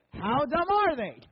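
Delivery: loud, high, drawn-out question; exaggerated, slow crowd shout; loud call-and-response cue. Shown as a demonstration. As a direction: belt it out like a crowd. Cues the audience's shouted reply.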